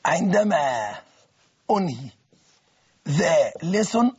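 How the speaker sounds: drawn-out word-by-word dictation; dictation pace. Shown as a demonstration. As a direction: dictate slowly, one word at a time. Dictates second example slowly.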